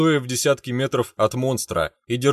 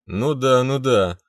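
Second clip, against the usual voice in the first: ordinary delivery, nothing marked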